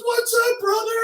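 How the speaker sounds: very high, loud mock holler; exuberant bro greeting. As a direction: imitate an over-friendly whoop of hello. Driver greeting the officer.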